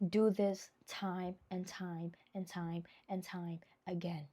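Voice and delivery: slow whispered instruction. Real, not acted. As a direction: whisper it slowly, as if sharing a secret.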